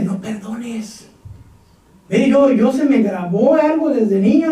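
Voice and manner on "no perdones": slow, soft tempting devil; devil's voice. Acted. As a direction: hiss the temptation slowly. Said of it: "el diablo me dice". (el diablo susurra lento y suave)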